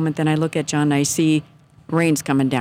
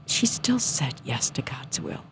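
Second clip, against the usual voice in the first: near-whisper, slow and much softer; hushed reverence; 3 semitones lower, 7 dB quieter, slower than usual. Real, not acted. Woman testifying about the Blessed Mother's yes, hushed. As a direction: drop into a slow, reverent hush.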